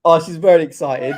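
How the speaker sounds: loud, amused remark through laughter; laughing. Real, not acted. Streamer laughs at excited mum, loud and amused. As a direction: crack up while announcing it.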